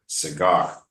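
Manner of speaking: ci-GAR, weight on GAR; Cigar, stress the second syllable; teacher modelling Cigar with stress on GAR. Shown as a demonstration. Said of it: "Like that".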